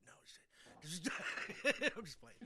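ordinary talk, nothing marked

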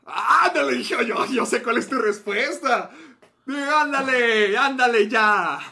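high, drawn-out excited shouting; loud, stretched-out urging to confess; loud teasing goading. Real, not acted. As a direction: egg your friend on at full volume. Goading co-host to answer.

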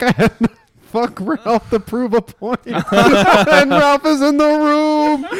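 mock-outraged quote breaking into laughs; laughing retelling. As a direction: drag the words out, cracking up. Retelling an absurd scene while laughing.

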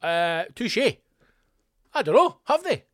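high, loud, thrown off balance; suddenly shaken, squeak the question back; mock startled doubt. Comic echoing the barber in shocked doubt. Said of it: "And I thought".

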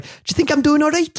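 high, innocent Geordie-ish voice; Gazza's cheeky question. Retold or imitated. Gascoigne pointing a gun at Vinnie, mock innocent. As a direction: play it daft and innocent. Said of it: "and it's like".

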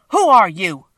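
loud challenge, falling at the end; crying out. A narrator's reading. Tin Woodman rushing at the little man, crying out. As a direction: shout it as a sharp demand. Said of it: "cried out".

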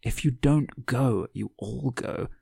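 slow, doubtful echo, fading at the end; skeptical repeat. A narrator's reading. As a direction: repeat it back, unconvinced. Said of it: "raised an eyebrow".

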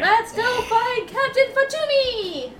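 slow, high, excited call; excited shout. Acted. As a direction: cheer it out eagerly.

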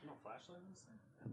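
high hushed murmur. Real, not acted. Hunting the spider in the dark, high and hushed.

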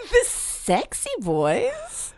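high, slow disbelieving echo; incredulous. Real, not acted. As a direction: drag it out in disbelief. Incredulous echo of gang name.

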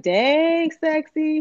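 flirty holler at her man below; calling out flirty. Retold or imitated. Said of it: "I was calling out the window like".

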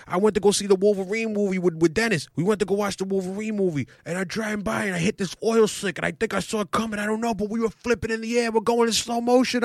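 high, rushed uncle telling the crash; imitating his uncle. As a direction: uncle reliving the flip, voice up high. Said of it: "He was like".

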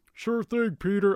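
a light Joe Swanson voice; Joe from Family Guy. Retold or imitated. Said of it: "it's Joe from Family Guy".

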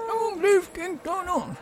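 slow, high squeaky cheer; high crowd cheer. Acted.

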